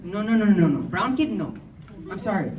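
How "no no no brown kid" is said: ordinary talk, nothing marked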